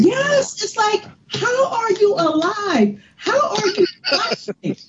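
high squeaky incredulous voice; how-can-you-exist squeal; incredulous outburst. Real, not acted. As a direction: pitch it way up in exasperated disbelief. Incredulous rant about foolish people.

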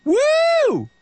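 sudden high whooping yell; a wild whoop of disbelief. Real, not acted. Exclaiming about a notorious son.

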